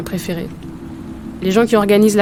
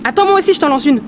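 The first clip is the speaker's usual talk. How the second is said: excited; 6 semitones higher, 4 dB louder, faster than usual. Acted. Eagerly throwing stone back.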